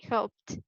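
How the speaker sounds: "helped" as one syllable ending in t; voiceless -ed ending. Shown as a demonstration. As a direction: close "helped" on a released t, not a d. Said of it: "we pronounce it as t".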